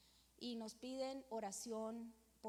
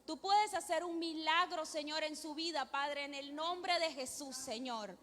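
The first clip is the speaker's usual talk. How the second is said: pleading prayer building louder and higher; fervent prayer; 3 semitones higher, 4 dB louder than usual. Real, not acted. Woman praying to God for a sick child's healing, fervent building prayer. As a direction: pray aloud with mounting force, phrase on phrase.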